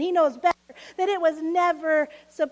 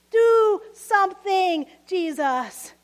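slow shouted prayer; 3 semitones higher, 7 dB louder, slower than usual. Retold or imitated. Worshipper shouting a prayer to Jesus, slow and loud. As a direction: drag the demand out. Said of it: "Anyone shout a similar prayer".

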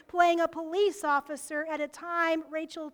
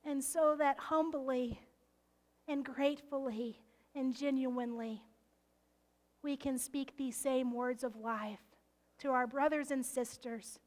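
prayer; 4 semitones lower, 5 dB quieter than usual. Real, not acted. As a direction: pray quietly and humbly. Leads closing prayer.

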